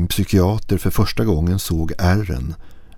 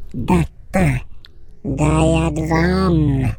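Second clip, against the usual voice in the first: bleating creature; 11 semitones higher, much slower than usual. Acted. Creature bleating its name at the narrator, high inhuman bleat. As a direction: bleat the sounds out like no living thing. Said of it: "Högre denna gång bräkte den fram ljuden".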